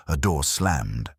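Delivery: usual speaking voice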